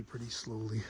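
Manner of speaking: ordinary talk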